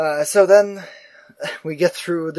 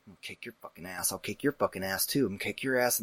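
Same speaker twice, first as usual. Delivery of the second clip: much softer, grumbling under the breath; muttering; 6 semitones lower, 12 dB quieter than usual. Retold or imitated. Angry old man grumbles threats at the phone user, softly muttered. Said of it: "nothing but muttering from this guy".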